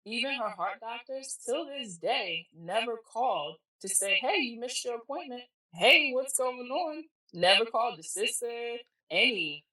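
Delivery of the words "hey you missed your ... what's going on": raised pitch, mock phone check-in; imagined check-in call. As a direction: play a doctor calling to check up.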